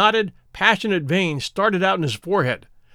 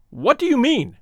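startled demand; 9 semitones higher than usual. Acted. Sterndale demands, agitated.

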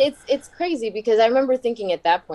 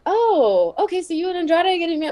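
fast, higher, bewildered question; surprised; 10 semitones higher than usual. Retold or imitated. Retelling a boss's reaction to the wedding news, surprised and quick. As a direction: catch him off guard and rush the question.

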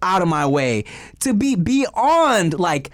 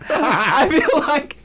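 slow, laughing through it; 8 semitones higher, much slower than usual. Real, not acted. Comedian still laughing at his own story, slow. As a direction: still laughing, words drawn out. Laughs while talking, slowed.

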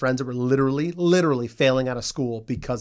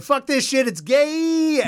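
higher, excited blurt; mock outburst; 11 semitones higher, much slower than usual. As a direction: blurt it out gleefully. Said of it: "immediately said".

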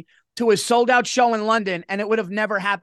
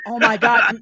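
loud, higher panicked gasp of a line; panicked oh my God; 3 semitones higher, 6 dB louder than usual. Retold or imitated. Comic arriving late to his own sold-out show, loud panic. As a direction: blurt it out in a rush of panic.

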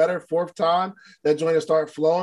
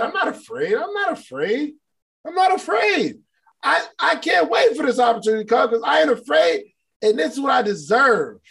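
rising declaration; 7 semitones higher than usual. Shown as a demonstration. Host leading viewers in a mounting chant of courage, shouting.

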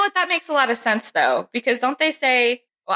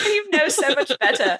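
high-pitched mock British scolding; laughing through a put-on British reproach; bad British accent; 8 semitones higher than usual. Podcaster echoing the UK friend's telling-off, bad British accent. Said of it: "He doesn't even sound like that".

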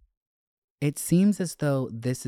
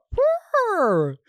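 much higher squealed purr; 6 dB louder than usual. Real, not acted. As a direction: squeal the word out high. Excited reaction to note.

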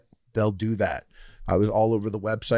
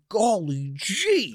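put-on high, slow wholesome old-timey voice; drawn-out corny gee-whiz exclamation; golly-gee old-timer; 10 semitones higher, much slower than usual. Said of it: "And you were like".

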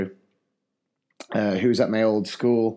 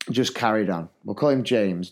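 ordinary delivery

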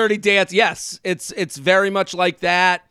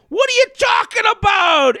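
baffled stranger; 12 semitones higher than usual. Retold or imitated. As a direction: shriek it as the shocked stranger.

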